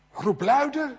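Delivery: high, loud taunt; mocking shout. Elia mocks the prophets calling on Baal, loud taunt.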